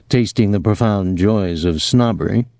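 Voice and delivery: usual speaking voice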